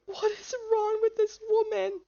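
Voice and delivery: small, high-pitched plaintive voice; high bewildered whine. Real, not acted. Player bewildered by this woman, high whine. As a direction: squeak it out helplessly, without shouting.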